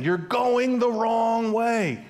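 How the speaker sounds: high, slow stretched-out yell; retold shout. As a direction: call it long across the lanes. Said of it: "So they say it again".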